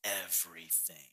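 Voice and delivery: soft, slow, weighted words; earnest quoting. Acted. Preacher pointing out Peter's answer to Jesus, soft and slow. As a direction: draw the words out softly, giving each weight. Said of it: "notice that Peter says".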